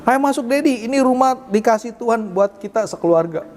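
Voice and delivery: imitating a small daughter's confident announcement, pitched high; loud, firm child. Daughter tells her dad about the new house, imitated loud and firm. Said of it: "Dia ngomongnya lantang tegas gitu".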